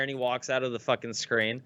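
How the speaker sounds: usual speaking voice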